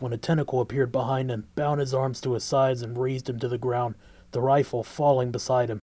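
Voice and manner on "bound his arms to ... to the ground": ordinary delivery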